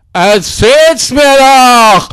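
stretched, shouted taunt; slow mocking shout. Taunting the resident, slow mock shout. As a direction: yell it out slowly, leaning on "mir".

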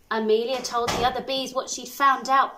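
ordinary delivery, nothing marked

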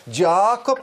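"Jacob" with a long, held A; lingering on A. Shown as a demonstration. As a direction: stretch out the A in "Jacob". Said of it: "att man ligger på A där". ("Jacob" med långt, utdraget A)

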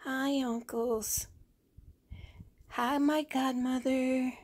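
put-on high, slow little baby voice; baby doll voice. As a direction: speak for the baby, sweet and high. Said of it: "She wants to say".

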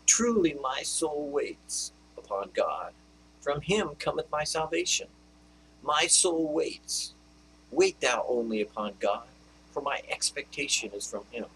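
hushed reverent recitation; meditative scripture reading. Acted. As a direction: linger on each word of the psalm.

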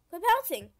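loud, high burst of exasperation; frustrated yell. Real, not acted. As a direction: yell at the game to stop. Frustrated yelling at bouncing in game.

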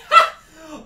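loud incredulous burst of laughter. Real, not acted. Gamer laughing loudly at getting teabagged, loud burst of laughter. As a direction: crack up at the insult.

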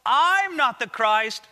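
"I'm" drawn out loud, then "not the Christ"; stress on I'M. Retold or imitated. John telling the delegation it is someone else, heavy stress on "I'm". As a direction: punch and stretch "I'm" to hint at someone else. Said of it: "this is the exact thing".

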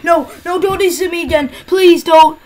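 real frustrated pleading; desperate protest. Real, not acted. Pleading as the game fails again.

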